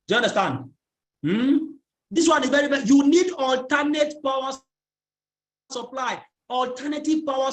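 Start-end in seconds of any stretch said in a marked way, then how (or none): none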